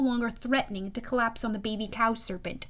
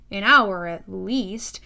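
ordinary delivery, nothing marked

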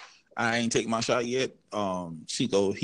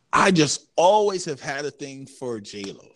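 ordinary talk

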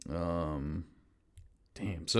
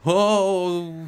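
dismayed; 11 semitones higher, 8 dB louder than usual. Real, not acted. Reaction to the dice roll.

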